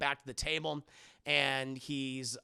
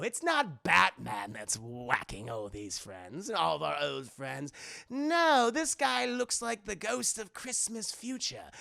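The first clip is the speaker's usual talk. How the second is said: theatrical villain demanding answers; Joker. Acted. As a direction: draw out the words, then swoop upward.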